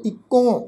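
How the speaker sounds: "ਇੱਕੋ" with a nasalised final o; nasal o ending. Shown as a demonstration. Teacher showing the reading, "ਇੱਕੋ" ending in nasal o. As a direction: let the last o of "ਇੱਕੋ" go through the nose. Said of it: "ਹੂੜੇ ਦੀ ਮਾਤਰਾ ਨਾਲ ਬਿੰਦੀ ਲਗਾ ਕੇ".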